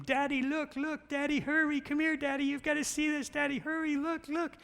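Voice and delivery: high, loud imitation of a small daughter shouting for dad; excited little girl calling. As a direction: squeal it like an eager little girl calling from her room. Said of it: "she is yelling from her room".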